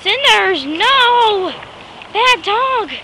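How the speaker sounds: loud, high reprimand; scolding the dog. Real, not acted. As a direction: tell off a naughty dog sharply.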